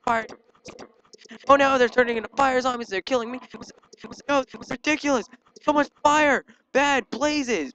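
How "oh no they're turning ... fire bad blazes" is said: high, loud alarm; frantic raised gamer complaint; panicked yelling. Real, not acted. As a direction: cry out as you are overwhelmed. Swarmed by fire zombies.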